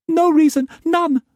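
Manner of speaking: put-on very high, pleading girlish voice; pleading young woman. A narrator's reading. Mei Mei begging Struan not to whip hard, high frightened pleading. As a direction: beg him, small and frightened.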